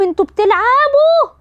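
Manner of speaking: a hint of a comic high-pitched outcry; quick mock protest borrowed from a movie; Mohamed Henedy quote. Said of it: "صورة محمد هنيدي وهم معلقينه وبيقول". (هنيدي وهو معلق يصرخ بسخرية: انتوا بتلعبوا)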